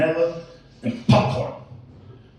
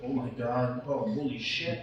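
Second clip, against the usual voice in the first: low, much softer murmur of disbelief; hushed shocked aside; 5 semitones lower, 12 dB quieter than usual. Retold or imitated. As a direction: say it under your breath while staring. His own quiet reaction, looking.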